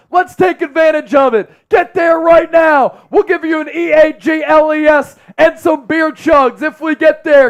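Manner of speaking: high, loud hype shout; rally the chat, shouting; excited shout. Real, not acted. Urging viewers to subscribe.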